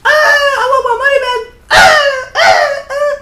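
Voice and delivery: high, loud, fake-crying whine of a spoiled fan; hammed-up tantrum, wailing and shouting; mock whiny crybaby. Mocking whiny complaining fans.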